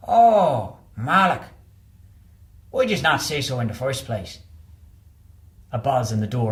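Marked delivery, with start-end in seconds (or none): 0.1-4.4 the doorman's penny drops; Irish doorman. Acted. Doorman finally recognising the colonel's name, loud surprised Irish voice.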